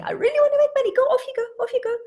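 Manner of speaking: high, loud, squealed encouragement; excited urging. Acted. Owner sending puppy after money, excited urging.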